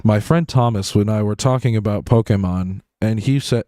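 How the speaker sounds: low, lifeless video narration; nonchalant recording voice. Shown as a demonstration. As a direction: read it with the personality switched off. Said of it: "the most nonchalant person on the planet".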